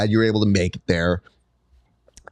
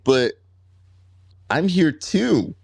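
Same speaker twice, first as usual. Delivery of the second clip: higher, drawn-out plea; retold childish protest; 7 semitones higher, slower than usual. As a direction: imitate a kid protesting to a parent. Retold kid protesting to dad.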